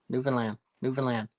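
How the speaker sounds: ordinary talk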